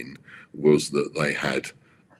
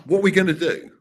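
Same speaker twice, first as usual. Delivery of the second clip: much higher and louder, gushing; excited Max; 13 semitones higher, 7 dB louder than usual. Retold or imitated. Max thrilled about the new deal, high excited mock gush. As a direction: burst out with eager delight. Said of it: "I remember him going".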